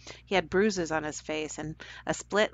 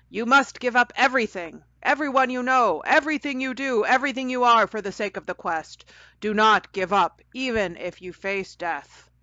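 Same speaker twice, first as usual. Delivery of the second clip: loud, higher proclamation; booming; 5 semitones higher, 6 dB louder than usual. Acted. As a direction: boom it out like a sorceress. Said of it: "she boomed out".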